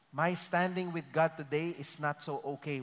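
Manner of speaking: low, soft worried voice; quiet doubting believer. Retold or imitated. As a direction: murmur like an unsure believer.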